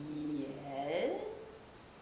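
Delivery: stately royal voice; very grand voice. Acted. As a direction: answer like a grand queen. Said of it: "said a very grand voice".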